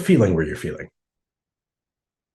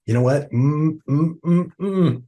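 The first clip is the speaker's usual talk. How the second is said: stifled hums; 8 semitones higher, slower than usual. Retold or imitated. Clamping down on frustration.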